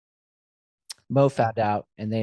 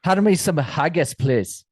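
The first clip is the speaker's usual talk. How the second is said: louder, higher amateur Scottish burr; Scottish accent; 4 semitones higher, 5 dB louder than usual. Shown as a demonstration. Host giving his best Scottish on request, hammed-up Scottish accent. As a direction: have a go at a thick Scottish accent. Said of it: "That's my Scottish".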